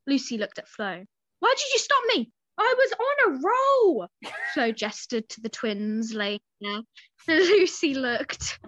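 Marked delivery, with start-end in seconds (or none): 1.4-4.1 high, loud, indignant whine; indignant protest. A narrator's reading. Lucy complains to Flo who stopped her, indignant. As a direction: complain loudly at being held back.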